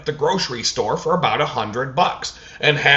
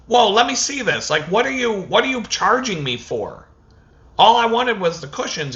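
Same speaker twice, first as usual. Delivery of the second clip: indignant questioning; 8 semitones higher than usual. Retold or imitated. Arguing with the cashier.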